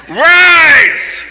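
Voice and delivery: high, loud hammed-up call to arms; rallying yell. Kid rousing the bullied victims, rallying shout. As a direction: shout it like a rebel leader. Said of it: "and I yell".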